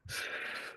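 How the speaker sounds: imitated anxious deep breathing; big forced breaths in and out, mimicking panic; panicked deep breaths. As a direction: act out over-breathing in a panic. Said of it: "someone who's having a panic attack".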